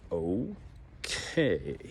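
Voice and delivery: a breathless whisper, caught off guard; hushed, uneasy okay; stunned whisper. Retold or imitated. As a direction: whisper it like you can't believe what you heard. Reacting to talk of murder.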